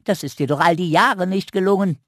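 high squirrel teasing the raven; cheeky squirrel retort. Acted. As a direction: fire back with a smirk.

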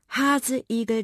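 usual speaking voice

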